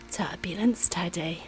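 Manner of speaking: ordinary delivery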